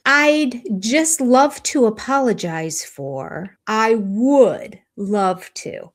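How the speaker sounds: mock halting delivery, words stretched slow; imitating drawn-out pauses. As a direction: drag each word out like he can't finish. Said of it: "he does these big, long, pregnant pauses".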